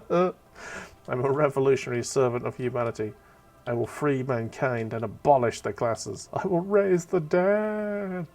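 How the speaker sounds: hammed-up rallying cry; grandiose proclamation. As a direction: declaim it, stretching the last phrase upward. Reads out the grandiose dialogue option.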